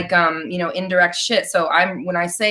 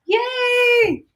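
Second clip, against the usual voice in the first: high, squealed cheer; excited cheer. Real, not acted. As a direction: celebrate a friend's win in a high voice. Cheering a viewer's breakthrough.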